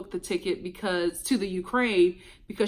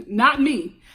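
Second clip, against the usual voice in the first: ordinary talk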